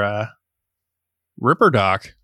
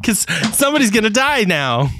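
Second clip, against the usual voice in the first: gleeful about a death, higher; inappropriately cheerful; 9 semitones higher than usual. Real, not acted. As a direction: relish the thought of death. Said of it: "Why do you sound so cheery?".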